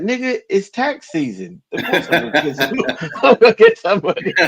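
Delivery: high-pitched, breaking into laughter; laughing retort. Real, not acted. Man mocking a vague money prophecy to his friend, laughing. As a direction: crack up through the words.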